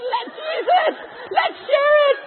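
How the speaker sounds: loud, high, bursting shout; excited shout. Pretend. Preacher voicing the believer's eager cry. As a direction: shout it out with joy.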